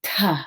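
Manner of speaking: "t" whispered with a strong puff of air; aspirated t. Shown as a demonstration. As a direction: breathe a hard burst after "t". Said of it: "a breath of air after the T sound".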